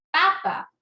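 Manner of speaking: "Papa" with a high, strong first PA; stress on first syllable. Shown as a demonstration. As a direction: hit the first PA of "Papa", then drop. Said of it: "¿escuchan?". ("Papa" con mayor fuerza en la primera PA)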